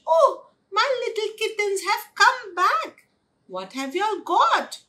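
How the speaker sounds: high, loud welcoming cry; delighted mama. A narrator's reading. Mama welcomes little kittens back, delighted greeting.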